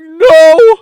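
high, very loud bawled denial; tearful denial yell. Retold or imitated. Crying boy denying it to his sister, bawling yell. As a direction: blast it out like a crying child. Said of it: "Clearly crying".

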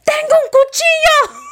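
high-pitched, loud reenacted scream. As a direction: scream it out like waking from a nightmare. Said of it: "I screamed".

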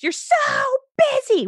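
Sex and the City's high, loud angry yell. Retold or imitated. Heroine to a guy who runs into her after a breakup, angry yell. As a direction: yell it sky-high, mock-furious. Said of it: "episode where she goes".

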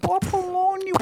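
voz de velhinha bem aguda; voz de velha. Retold or imitated. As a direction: imite a velha surda da praça, esganiçada. Imitando a véia surda. (voz de velhinha aguda e alta)

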